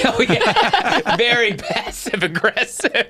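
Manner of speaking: high, giggly reply through a laugh. Real, not acted. Reacting to her friend's shush, real laughter.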